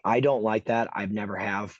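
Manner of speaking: ordinary talk, nothing marked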